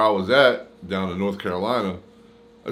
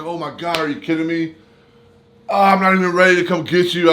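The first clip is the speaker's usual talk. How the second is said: higher, louder surprised mom; 8 semitones higher, 5 dB louder than usual. Retold or imitated. Mom on the phone hearing he's coming home, surprised. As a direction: mom caught off guard by the news. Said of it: "She was like".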